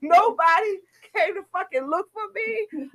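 high, indignant and laughing through it; pissed-off complaint. Retold or imitated. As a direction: let them have it for forgetting you. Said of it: "I'm so pissed".

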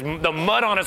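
ordinary talk

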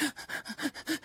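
quick breathless gasp, no voice; sharp gasp. Retold or imitated. Remembering facing the close person, a sharp gasp. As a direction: suck in air in surprise. Startled before speaking to friend.

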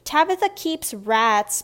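high, loud tattletale outburst; loud accusing schoolboy; blurted. A narrator's reading. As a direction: blurt out the secret, drawing out the words. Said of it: "Barnaby blurted".

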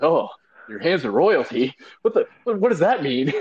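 high, rising incredulous question; can't believe what you just heard; incredulous disbelief. Retold or imitated. Worker mocks coworker's royal hands claim, high incredulous. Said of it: "I'm like".

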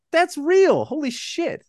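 high-pitched, re-enacted astonishment; retold amazement. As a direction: act out being floored by it. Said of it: "I'm like".

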